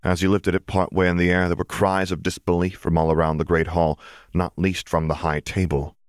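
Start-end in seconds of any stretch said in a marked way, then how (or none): none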